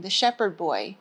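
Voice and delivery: ordinary delivery, nothing marked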